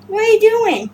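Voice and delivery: high-pitched question to a pet; pet talk. Real, not acted.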